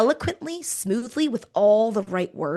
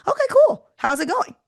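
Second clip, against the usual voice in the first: much higher and louder, bright and unbothered; breezy acceptance; 10 semitones higher, 4 dB louder than usual. Shown as a demonstration. Recruiter shrugging off a client's no, much higher and louder. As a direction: brush off the objection cheerfully. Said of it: "Accept the objection and continue".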